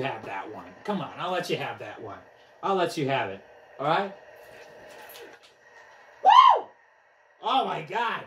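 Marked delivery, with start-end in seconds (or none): 6.2-6.7 very high, loud "woo"; piercing falsetto whoop. Real, not acted. Player celebrates a pitch, high whoop.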